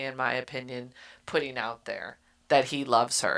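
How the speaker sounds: normal talking voice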